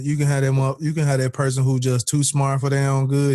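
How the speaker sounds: usual speaking voice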